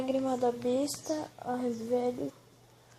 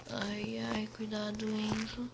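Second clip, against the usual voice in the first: whispering; 4 semitones lower, 9 dB quieter than usual. Real, not acted. Scared whisper in dark cave.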